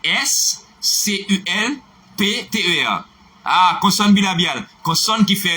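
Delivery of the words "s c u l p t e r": slow letter-by-letter spelling of S C U L P T E R; spelled out. Shown as a demonstration. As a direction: spell each letter S C U L P T E R deliberately. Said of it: "avec moi".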